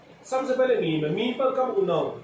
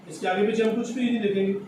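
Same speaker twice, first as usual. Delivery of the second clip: normal talking voice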